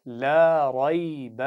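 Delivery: drawn-out, melodic tajweed recitation; slow Quran recitation. Shown as a demonstration. Teacher reciting slowly for pupils to repeat. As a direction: chant each syllable slowly for the class to copy. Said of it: "बा नहीं पढ़ेंगे".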